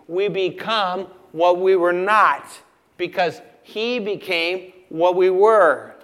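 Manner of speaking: measured, rising-force pulpit cadence; emphatic preaching. Real, not acted. Preaching in emphatic parallel phrases.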